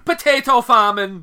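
loud, high, drawn-out mock-Irish accent; Irish accent attempt. Shown as a demonstration. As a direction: show off a hammed-up Irish accent. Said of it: "let me try again".

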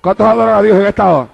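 loud, emphatic preaching; prédica a plena voz. Real, not acted. Predica a plena fuerza. (predicando con fuerza)

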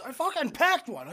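laughing imitation. Friend imitating the teammate's protest, laughing. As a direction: protest while cracking up. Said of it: "I still remember".

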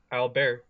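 "Albert" said the French way, al-BEAR; French "Albert" with no final T; silent T. Shown as a demonstration. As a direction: drop the T at the end of "Albert". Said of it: "you don't pronounce the T".

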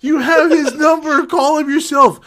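high-pitched mocking imitation; squeaky voice mocking the friend.

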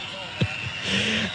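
soft, breathy burst of laughter; delighted laugh. Real, not acted. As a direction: laugh with real glee. Delighted by a clip.

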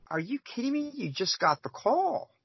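high-pitched, exasperated disbelief; incredulous retort. Retold or imitated. Referee answering a coach who wants a call, incredulous. Retelling reaction to coaches' complaint.

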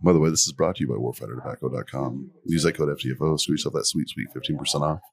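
low, casual sponsor plug; ad read. Acted.

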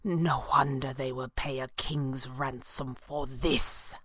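low, hushed, slow murmur; barely a whisper. A narrator's reading. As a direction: keep it soft, low and unhurried. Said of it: "his voice barely a whisper".

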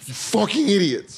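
high-pitched comic contempt; hammed-up frustrated name-calling; mock exasperated insult. Waiter cursing clueless diners, mock exasperation. Acted scolding of ignorant customers.

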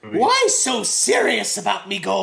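a hint of the Joker in a mock Irish Leprechaun voice. Host riffs on the new Leprechaun actor, mock Joker voice. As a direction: growl it like the Joker playing a leprechaun. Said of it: "That was pretty good".

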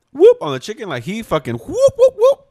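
loud, high squeaky whoop noises; playful sound effect; imitated whoop sounds. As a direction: mime the sauce splatting with a rising whoop. Mimicking smearing sauce.

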